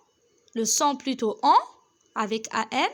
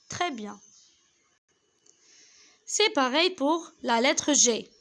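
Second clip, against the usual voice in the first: usual speaking voice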